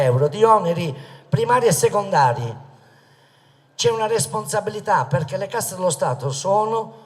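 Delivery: loud, high, impassioned rally oratory; booming balcony speech. Acted. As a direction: thunder it out to the crowd like a politician. Rally speech at full force.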